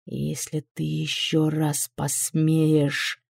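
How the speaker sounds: low, slow, menacing demon voice; slow low threat from a demon; cold menacing man. A narrator's reading. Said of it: "начал мужчина".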